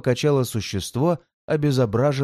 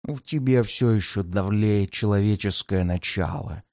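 low demon voice; 5 semitones lower than usual. Acted. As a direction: preach the law of the strong. Demon lectures on might.

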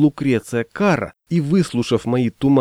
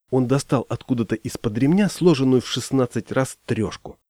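plain reading voice

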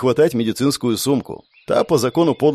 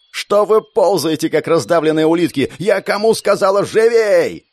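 yelling; 6 semitones higher, 3 dB louder than usual. A narrator's reading. Gerda yells at crawling soldiers, furious. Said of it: "заорала на солдаток".

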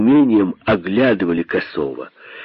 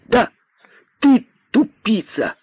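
high, gleeful taunt; ecstatic cry; 12 semitones higher than usual. A narrator's reading. Rabbit confirms the insult to the python, ecstatic. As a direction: call it out delightedly, pitch way up. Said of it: "восторженно выкрикнул кролик".

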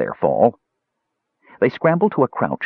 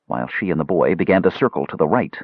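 ordinary delivery

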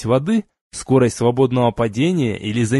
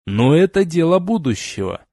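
usual speaking voice

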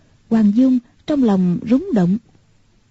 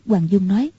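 ordinary delivery, nothing marked

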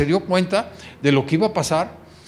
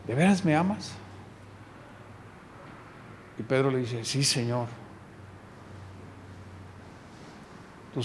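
tender exchange; 5 semitones lower, 11 dB quieter than usual. Acted. Jesus and Peter speak in hushed tenderness. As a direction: lower and much softer, hushed.

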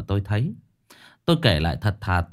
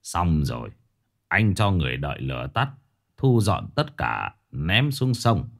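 put-on stern old master; 5 semitones lower than usual. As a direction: command the workers calmly from low in the chest. Master gives final instructions. (giọng thầy pháp trầm, chậm rãi)

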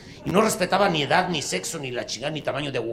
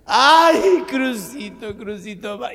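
melodramatic sigh; 9 semitones higher, 7 dB louder, slower than usual. Acted. Mother sighs name.

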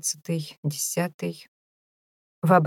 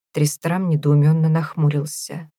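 usual speaking voice

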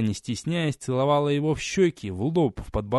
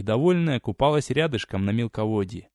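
ordinary delivery

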